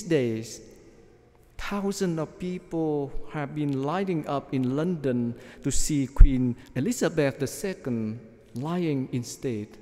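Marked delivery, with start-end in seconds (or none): none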